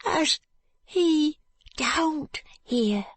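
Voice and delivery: high, slow and tearful; scared he might be overheard; fearful. Acted. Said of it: "shaking his head fearfully".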